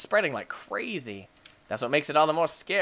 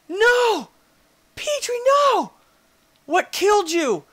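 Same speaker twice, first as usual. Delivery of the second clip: very high loud despairing cry; anguished high cry; 8 dB louder, slower than usual. Real, not acted. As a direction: scream as the pet dies. Pet dies.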